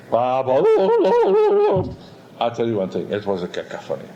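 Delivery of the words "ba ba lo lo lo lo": loud, very high imitated trumpet wobble; botched trumpet note, wailed high and loud; cacophonous trumpet blast. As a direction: blare like a trumpet cracking out of control. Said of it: "all of a sudden you hear".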